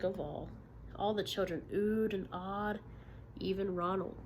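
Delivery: lively read-aloud for a class; storybook narration; story reading to children. Acted. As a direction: tell the story to young listeners. Teacher reads picture book aloud.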